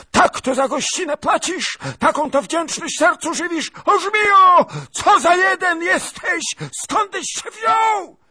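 very high, loud furious shrieking; raging old woman. Acted. Princess rages at the lieutenant, shrieking. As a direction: spit fury at the ungrateful guest.

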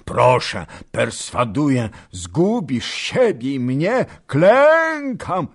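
gasping as he begs; panting, broken voice. Acted. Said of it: "mówił przerywanym głosem sapiąc". (zdyszany, przerywany głos)

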